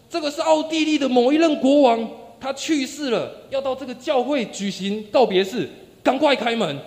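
high, loud formal announcement; loud shout. Retold or imitated. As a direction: proclaim it loudly, demand entry. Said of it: "带领者会再大声地喊".

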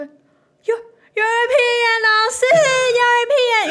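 high, loud, whiny pupils calling out; eager kids. Retold or imitated. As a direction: chant it like proud little students. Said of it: "所以他们就会".

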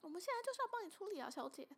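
high, fast mock mainland Chinese accent. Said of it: "这种，这种概念". (模仿诈骗者的大陆腔，又快又高)

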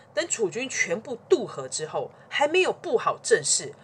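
ordinary delivery

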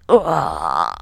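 low strangled groan; hammed-up dying grunt; mock death groan. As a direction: groan as if stabbed. Stabbing herself in imagination.

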